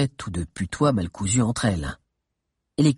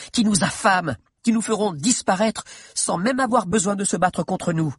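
pressing, raised-pitch plea; insistent arguing; 6 semitones higher than usual. Acted. Girl urging her chief toward war, insistent. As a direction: urge them hard, pitch lifted. Mordelia argues urgently for war.

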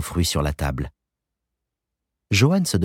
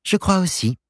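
put-on young woman voice; 12 semitones higher than usual. A narrator's reading. Joanne voiced in story.

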